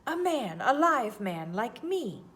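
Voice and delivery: high, loud, slowing on the last words; excited gabbling. A narrator's reading. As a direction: cry it out in creature wonder. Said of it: "gabbled my conductor".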